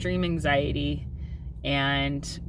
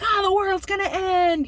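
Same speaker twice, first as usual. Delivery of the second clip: high, overblown despair; mock melodrama; 5 dB louder than usual. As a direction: ham up a tiny disaster. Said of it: "I'm like".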